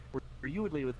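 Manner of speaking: ordinary talk, nothing marked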